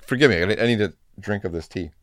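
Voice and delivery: ordinary delivery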